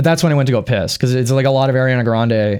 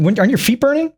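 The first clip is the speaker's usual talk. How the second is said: mock incredulous; 11 semitones higher than usual. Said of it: "I was like".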